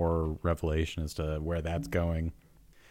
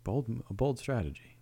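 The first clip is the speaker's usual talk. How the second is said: golf commentator voice; 4 semitones higher, 5 dB quieter than usual. Pretend. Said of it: "keeps the commentator voice".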